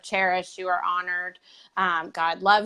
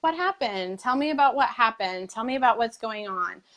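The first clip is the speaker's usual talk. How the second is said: tender motherly voice; 4 semitones higher than usual. Retold or imitated. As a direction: coax gently, the way a mom soothes her daughter. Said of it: "the same way I would talk to, um, you know, one of my daughters".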